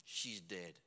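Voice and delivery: grave, quiet pulpit storytelling; sombre preaching. A narrator's reading. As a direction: let the grief sink in, very softly. Hushed grief narration in sermon.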